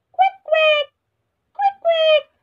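very high, loud quacks; duck quacking. Shown as a demonstration. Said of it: "the sound that duck make is".

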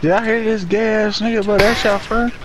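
ordinary delivery

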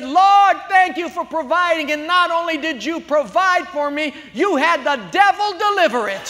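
very high, loud praise cry; joyful praise. Acted. Lady thanking God in front of her neighbor. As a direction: praise with delight. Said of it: "She said".

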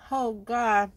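strained loud groan; pained groan. Real, not acted. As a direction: groan through the burn. Eyes watering from wasabi.

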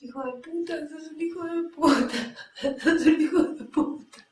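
high, loud, sobbing insults building up; furious crying curse. Acted. Betrayed woman hurling insults at the man who lied. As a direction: break down cursing him, half laughing half crying. Cursing the liar after the call.

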